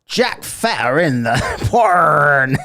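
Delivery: loud, slow laughing blurt of the misread name. Real, not acted. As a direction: blurt the wrong word out through a laugh. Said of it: "Jack Fetter in the chat".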